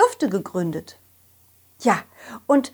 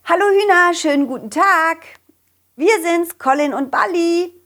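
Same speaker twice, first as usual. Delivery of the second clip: loud cheerful greeting; calling out; 8 semitones higher, 6 dB louder than usual. Acted. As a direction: call across the yard. Calling loudly to chickens.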